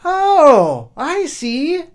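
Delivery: put-on high, loud boyish realization; eager boy. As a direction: foolish boy thinks he understands, high and loud. Boy grasps it.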